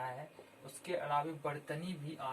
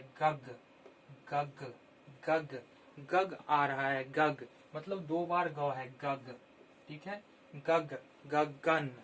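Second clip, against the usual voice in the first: गग punched louder, repeated; sounding out; 4 dB louder, slower than usual. Shown as a demonstration. Teacher breaking गगन into sounds for young learners. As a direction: sound out गग again and again, then गगन. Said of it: "ग से letter शुरू हो रहा है".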